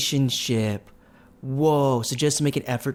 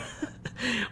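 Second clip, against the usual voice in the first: soft laugh; 10 semitones higher, 11 dB quieter than usual. Real, not acted. Laughing at first painting.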